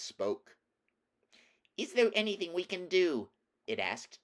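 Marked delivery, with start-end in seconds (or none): 1.8-3.3 high little mouse voice; helpful mouse. A narrator's reading. Big mouse offers the woodman a favour, high mouse voice.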